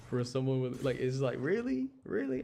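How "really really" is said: high-pitched mock disbelief; incredulous reaction. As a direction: imitate a doubting listener, pitched way up. Voices a listener doubting the accent.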